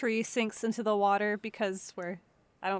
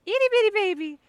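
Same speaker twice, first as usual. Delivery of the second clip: put-on baby talk; 10 semitones higher, 8 dB louder, faster than usual. Cooing over a tiny die.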